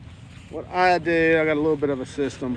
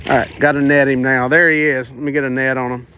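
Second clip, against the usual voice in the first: phone held in teeth; 7 dB louder than usual. Real, not acted. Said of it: "I've got the phone in my mouth".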